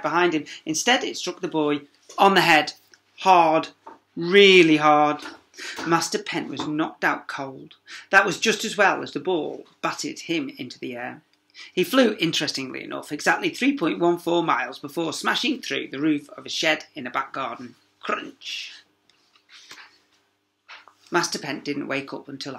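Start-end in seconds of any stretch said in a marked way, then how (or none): 4.2-5.2 slow, loud, leaning hard on really; emphatic, slow. A narrator's reading. Reading to a child, stressing really hard. 18.0-18.7 breathy whispered crunch noise; a hushed crunch as he hits the shed. A narrator's reading. Reading to a child, a whispered crunch.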